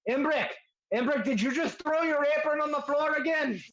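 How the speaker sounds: very high-pitched exasperated holler; scolding from the back. Acted. Husband scolds Imbric over dropped apron, exasperated yell. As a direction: yell at a messy partner in character. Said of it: "the other voice in the back go".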